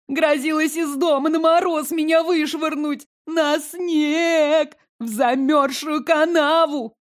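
high, put-on sobbing complaint; theatrical sobbing. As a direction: sob it like a hammy liar. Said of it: "театрально заламывая руки".

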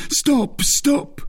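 very high, panicked plea; terrified cry. A narrator's reading. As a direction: cry out to halt the blade. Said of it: "by force of terror".

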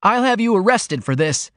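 high, indignant threat; snarled. A narrator's reading. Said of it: "he snarled".

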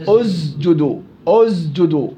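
أسجدوا with the س voiced like ز; wrong z in أسجدوا; voiced seen error. Shown as a demonstration. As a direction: buzz the س in أسجدوا as a z. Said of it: "يلفظها البعض".

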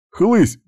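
loud, high spoken whack; imitated smack. A narrator's reading. Narrator sounding repeated tile blows, loud high smack. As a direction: crack it out like a hit.

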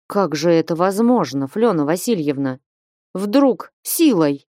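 ordinary delivery

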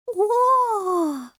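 put-on long, very high girlish "oh"; respectful drawn-out awe. A narrator's reading. Little princess impressed by the kittens, awed drawl. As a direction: draw it out, deeply impressed. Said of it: "уважительно протянула принцесса".

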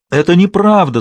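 loud, high-pitched outcry of denial; anguished cry. A narrator's reading. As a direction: cry it out in horror, voice leaping up. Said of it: "закричала она страшным голосом".

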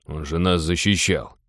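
a mild, low-key reproach; understated reproachful objection. A narrator's reading. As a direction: remind them with a touch of reproach. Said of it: "сукоризной напомнил Тодор".

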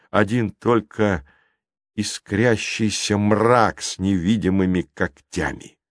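plain reading voice

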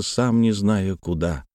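usual speaking voice

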